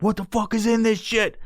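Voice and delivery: high-pitched incredulous question; alarmed disbelief. Retold or imitated. Stoner looking at the box, alarmed.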